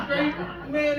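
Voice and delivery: ordinary talk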